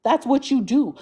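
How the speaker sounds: ordinary talk, nothing marked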